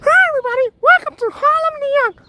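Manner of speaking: very high, loud squeaky puppet voice; Elmo voice. Acted. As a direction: chirp like Elmo greeting the crowd. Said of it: "[name]'s got split personality".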